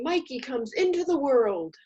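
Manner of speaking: ordinary talk, nothing marked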